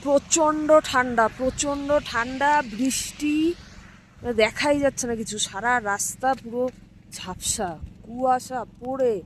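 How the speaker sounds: calling out over the wind; loud. Real, not acted. Outdoors in freezing wind and rain.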